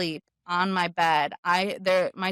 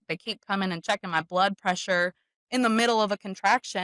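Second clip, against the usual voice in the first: ordinary talk, nothing marked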